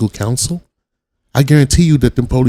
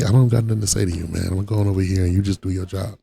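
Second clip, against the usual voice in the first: ordinary talk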